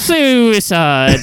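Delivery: "suicide" with a loud, long shushed S; lispy suicide. Retold or imitated. Insisting that's truly how they say it, lispy suicide. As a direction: hit a hissing "shoo" at the start of "suicide". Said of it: "you're not exaggerating it".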